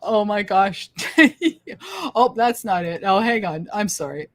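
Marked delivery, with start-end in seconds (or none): 0.0-1.3 laughing through the words; real laughter. Real, not acted. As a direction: let the laugh break through. Laughing at a viewer comment.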